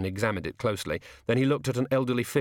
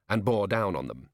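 ordinary delivery, nothing marked

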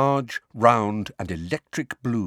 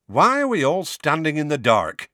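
put-on higher, louder brisk young woman; young woman's voice; 6 semitones higher, 5 dB louder than usual. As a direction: play a lively young witch. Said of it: "a woman's".

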